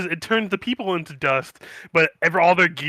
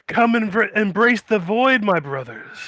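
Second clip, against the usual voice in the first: slow, higher preaching cultist; 6 semitones higher, 3 dB louder than usual. Acted. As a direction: beckon them to the void. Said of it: "be like".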